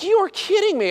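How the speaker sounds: high, astonished exclamation; can't believe your eyes; stunned disbelief. Retold or imitated. Dentist gushing over patient's fake hair, stunned.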